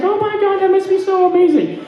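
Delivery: very high, gushing mock enthusiasm. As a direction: imitate people squealing over grandkids. Mimics people gushing about grandkids.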